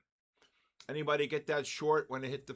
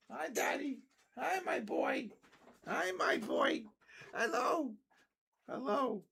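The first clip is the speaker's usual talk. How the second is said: put-on much higher, sing-song baby voice for the pet; 13 semitones higher than usual. Owner voicing his pet greeting daddy, high baby voice. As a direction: talk like the little one greeting daddy.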